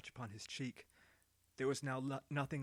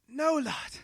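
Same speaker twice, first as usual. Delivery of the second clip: much higher, louder breathless no; gasped refusal; 13 semitones higher, 10 dB louder than usual. Acted. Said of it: "Ado gasped".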